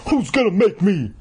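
high, defiant taunt; demanding. A narrator's reading. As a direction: throw it out as a dare. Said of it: "he demanded".

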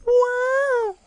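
very high, stretched-out wow; high-pitched acted wonder; mouthed amazement. A narrator's reading. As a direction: let a thin, awed wow float up. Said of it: "Sari mouthed the word silently".

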